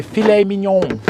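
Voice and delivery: "filet mignon" as fi-LAY min-YON; French filet mignon. Shown as a demonstration. Explaining French versus Aussie words, "filet mignon" the French way. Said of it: "as they say in most parts of France".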